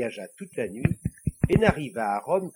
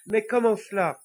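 high, louder little devil question; puzzled little devil; 8 semitones higher, 5 dB louder than usual. Acted. Little devil asking the Pope how, high and puzzled. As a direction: ask eagerly in a small high voice.

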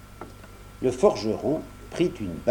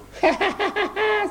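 very high mocking snicker; squirrel's gleeful cackle; squirrel sniggering. A narrator's reading. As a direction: snigger down from the oak. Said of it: "ricana en l'apercevant".

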